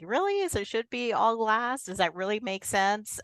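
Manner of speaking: high, incredulous "really?", then easing down; sceptical reaction. Retold or imitated. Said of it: "I'm like".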